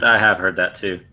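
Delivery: ordinary delivery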